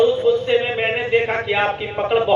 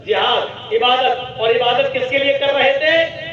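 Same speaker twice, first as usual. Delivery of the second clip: ordinary talk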